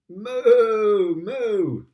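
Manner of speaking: loud, high mooing like a cow. Shown as a demonstration. Reading to a child, showing how the cow goes moo. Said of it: "He can sound like a cow".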